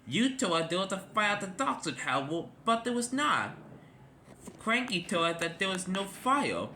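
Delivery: indignant, pitch pushed up; cross. A narrator's reading. Rescue helicopter scolding Charlie for the fake fire, cross. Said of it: "They were very cross".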